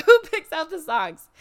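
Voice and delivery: loud question through a laugh; laughing repeat. Real, not acted.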